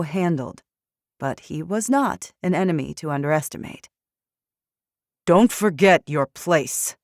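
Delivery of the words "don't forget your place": slow, loud angry snap; spat. Acted. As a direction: spit it out with contempt. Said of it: "the general spat".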